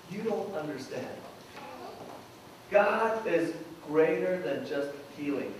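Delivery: soft, low, measured assurance; hushed, patient explanation; gentle correction. Retold or imitated. Jesus gently corrects the mourners.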